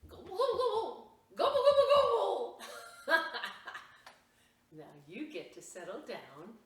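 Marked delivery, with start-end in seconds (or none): 0.1-2.6 very high, loud gobbling; turkey impersonation. Shown as a demonstration. Storyteller shows the children a best turkey impersonation, shrieking high gobbles. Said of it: "Best turkey impersonation". 2.7-4.2 loud burst of laughter; real laugh. Real, not acted. Storyteller laughs after the turkey impersonation, a loud real laugh.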